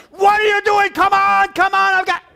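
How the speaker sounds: 模仿不耐烦的大喊; mock impatient yell. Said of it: "I'm like".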